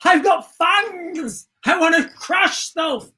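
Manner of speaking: high, shouted dumb orc voice. Pretend. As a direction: bellow it like a stubborn orc who only wants to smash. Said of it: "And he's like".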